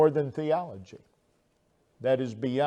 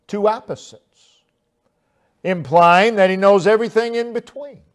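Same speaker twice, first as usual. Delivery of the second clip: rising, louder pulpit delivery; fervent preaching; 4 semitones higher, 5 dB louder than usual. Acted. Preacher stirring his congregation, fervent preaching. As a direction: press the point home to the congregation. Preacher expounds the psalm verse.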